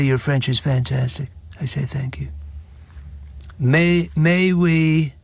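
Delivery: mock Trump boasting in short, level bursts; put-on voice of a bragging statesman; boastful politician impression. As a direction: parody a self-praising president, phrase by phrase.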